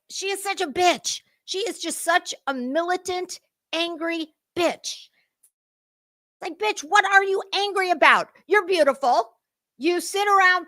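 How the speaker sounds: high, scornful voice; sneering rant. Real, not acted. Ranting at a TV host, high and scornful. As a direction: sneer it at her.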